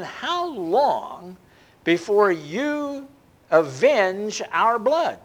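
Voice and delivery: slow, drawn-out lament; pleading cry. Acted. Martyrs pleading with the Lord, slow lament. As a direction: stretch each word in longing. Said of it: "they're crying to the Lord and saying".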